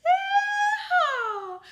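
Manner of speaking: high, loud whooping yeehaw with a laugh; big joyful cowboy whoop. Acted. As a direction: holler it like a cowboy calling the cattle in. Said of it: "when I let out my cowboy call".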